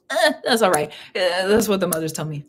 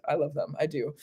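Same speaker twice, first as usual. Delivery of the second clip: soft; 3 semitones lower, 7 dB quieter than usual. Real, not acted. Repeating love for fairies.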